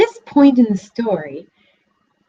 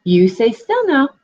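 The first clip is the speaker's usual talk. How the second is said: higher, firm fox voice; coaxing fox; 6 semitones higher, 4 dB louder than usual. A narrator's reading. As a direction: settle the piglet down firmly. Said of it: "said the fox".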